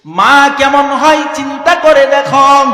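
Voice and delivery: impassioned chanted preaching, swelling into high, loud cries; melodic sermon storytelling. Acted. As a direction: draw the line endings out into ringing wails.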